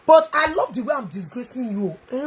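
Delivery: usual speaking voice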